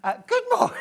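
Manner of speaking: very high, loud startled squeal; startled greeting. Real, not acted. Host startled by a viewer's message. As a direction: caught off guard by the message. Surprised greeting, then laughs.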